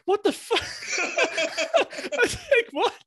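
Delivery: very high-pitched squeal of disbelief, breaking into laughter; retold outburst of baffled shock; incredulous. Son re-enacting his reply to dad's question, laughing and incredulous.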